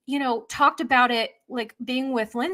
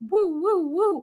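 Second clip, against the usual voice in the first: mind-blown woo; 6 semitones higher, slower than usual. Retold or imitated. Mind-blown reaction sound.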